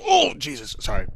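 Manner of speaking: normal talking voice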